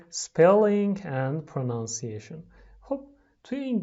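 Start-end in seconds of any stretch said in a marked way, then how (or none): 0.1-2.4 very slow, loud section title; slow announcement. Shown as a demonstration. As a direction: announce the lesson part clearly. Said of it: "announcing lesson section".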